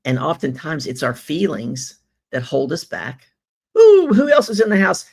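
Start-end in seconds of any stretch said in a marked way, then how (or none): 3.8-5.1 high, loud, thrilled welcome; delighted shout-out to a viewer; excited greeting. Real, not acted. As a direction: call out the name with delight.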